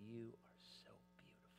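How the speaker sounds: soft whispered compliment; tender whisper. Retold or imitated. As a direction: whisper gratitude to the nurse. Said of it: "and he goes".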